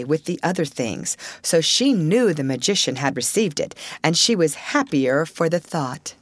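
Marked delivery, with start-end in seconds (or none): none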